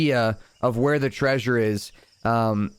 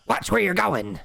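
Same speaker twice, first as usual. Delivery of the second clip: higher, odd-sounding snake voice; 7 semitones higher than usual. Acted. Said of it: "it says".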